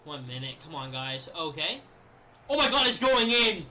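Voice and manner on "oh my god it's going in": loud, slow, rising thrill as it goes in; excited shout. Real, not acted. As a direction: cheer the ball into the net. Tying goal goes in.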